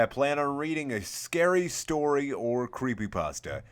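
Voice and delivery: ordinary delivery, nothing marked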